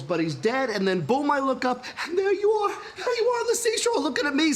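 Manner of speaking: ordinary delivery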